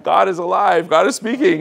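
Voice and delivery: high, loud re-enacted rejoicing; exultant. Teen rejoicing at dad's permission, exultant. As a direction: exclaim it in joyful amazement, pitch up.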